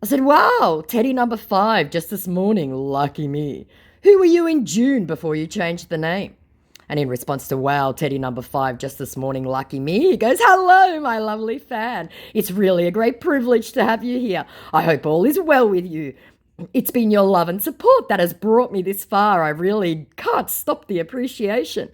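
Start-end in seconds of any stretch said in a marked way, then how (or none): 0.2-6.3 higher, louder mock amazement; sarcastic wow. Sarcastic greeting to another fake Teddy. 10.4-21.9 high, loud, effusive greeting; gushing fake celebrity. Acts out scammer's gushing fan greeting.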